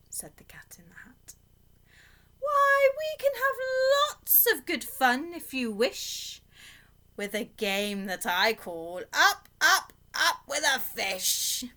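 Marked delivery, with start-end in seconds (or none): 2.4-11.6 high, slow, delighted cat pitch; a grand showman cat; showy cat. Acted. Cat in the Hat voice.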